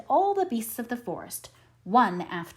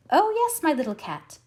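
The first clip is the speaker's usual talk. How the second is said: high, loud eager cry; cried out; 8 semitones higher, 4 dB louder than usual. A narrator's reading. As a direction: call out eagerly from upstairs. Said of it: "she cried".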